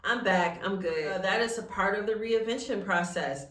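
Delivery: usual speaking voice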